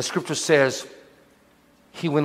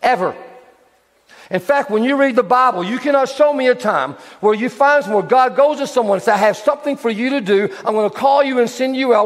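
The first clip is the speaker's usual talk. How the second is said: raised, higher preaching to the church; 10 semitones higher, 4 dB louder than usual. Acted. Pastor urging the church, full-force preaching. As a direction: press the point home from the pulpit.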